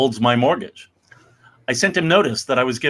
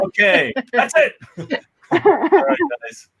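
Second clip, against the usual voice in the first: laughing sign-off; 13 semitones higher than usual. Real, not acted. Host laughing as he signs off to the guys. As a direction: wrap it up while cracking up.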